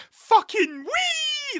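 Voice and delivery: very high, loud, stretched-out shriek; kids' cheer. Retold or imitated. Mimicking the schoolkids' cheer, very high slow shriek. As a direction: screech it like a bus full of hyped kids. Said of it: "for them all to go".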